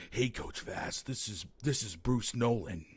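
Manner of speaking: low, hushed impression of a co-host; Bruce Nolan. Co-host introducing himself off air, low hushed imitation. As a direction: drop it soft and low, almost whispered. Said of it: "your regular non-pod voice sounds like".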